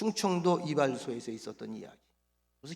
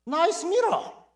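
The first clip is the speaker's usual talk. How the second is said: put-on slow, high, foreign-accented English; foreigner's English; 13 semitones higher, 9 dB louder, much slower than usual. Foreign customer admiring the mirror in accented English. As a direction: drawl the English like a visitor. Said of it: "이 외국 사람이 뭐라 그랬겠어요".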